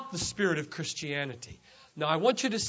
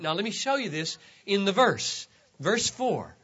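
ordinary delivery

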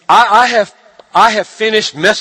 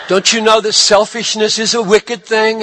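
usual speaking voice

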